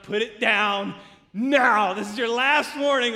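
loud, forceful final warning; stern mock yell. Father ordering son to drop gun, stern shout. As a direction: imitate a dad raising his voice to be obeyed.

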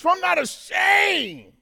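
slow, high, loud proclamation; full-force preacher's cry; preaching shout. Real, not acted. Preaching climax on Romans.

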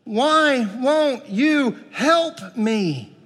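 high, slow, anguished pleading, no real scream; a parent kneeling before Jesus, mildly acted despair; desperate cry. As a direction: drag out the plea like a broken parent. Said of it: "screaming in utter desperation".

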